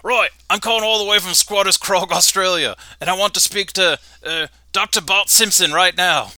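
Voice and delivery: Australian caller demanding Doctor Bart Simpson; Australian dad on phone. Acted. Host voices Bruno on phone.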